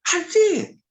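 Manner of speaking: admitting to the brother he thought he'd lost it, high and loud; emphatic yes. Retold or imitated. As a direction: blurt it out loud, eyes wide. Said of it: "I said".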